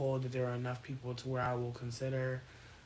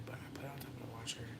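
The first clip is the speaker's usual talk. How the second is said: fast whisper; quick whispered aside; 3 semitones lower, 11 dB quieter, much faster than usual. Real, not acted. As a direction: rush it in a whisper. Late night murmur.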